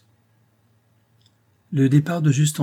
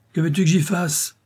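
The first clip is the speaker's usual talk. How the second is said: exclamation; 7 semitones higher than usual. Acted. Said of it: "s'écrie madame Brun".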